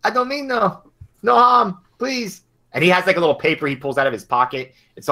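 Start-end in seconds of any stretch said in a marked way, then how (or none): 0.0-2.4 put-on high, pleading frightened old man. Old noodle seller begging the cop, high and terrified. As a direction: beg for mercy in a high quavering voice.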